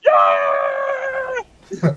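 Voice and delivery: high, loud group cheer; cheering yell. Retold or imitated. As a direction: whoop it up like a crowd cheering. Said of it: "we all went".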